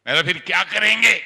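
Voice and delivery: high, loud exasperated yell; angry shout. Retold or imitated. Shouting at Didi over the phone, angry. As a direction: throw the question back in outrage. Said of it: "तो गुस्सा है".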